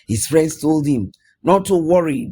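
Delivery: ordinary delivery, nothing marked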